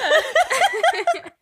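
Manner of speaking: loud high burst of laughter; big laugh. Real, not acted. Laughing hard mid-story.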